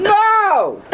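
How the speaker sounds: high, drawn-out exclaimed NO; soaring no that drops at the end; emphatic objection. Real, not acted. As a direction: cry out a protesting no, way up high. Rejects the complaint about screaming.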